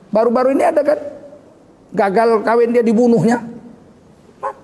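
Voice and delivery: preacher at full force; fiery preaching. Acted. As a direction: drive the point home to the congregation.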